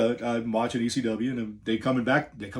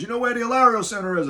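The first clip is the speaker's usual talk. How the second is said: high, loud New York Bronx caller voice; heavy Bronx accent; 12 semitones higher, 5 dB louder, faster than usual. Retold or imitated. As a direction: imitate a brash Bronx security boss on the phone. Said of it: "Very heavy New York Bronx accent".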